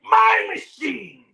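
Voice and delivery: high, slow, crying shout of my machine; doctor yelling at the interns, slow furious shout. Retold or imitated. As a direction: wail it like a possessive tantrum. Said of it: "Kenny Omega would be the guy".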